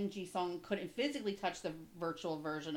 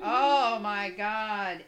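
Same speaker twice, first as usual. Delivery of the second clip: emotional; 2 semitones higher, 10 dB louder, much slower than usual. Real, not acted. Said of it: "had me in tears".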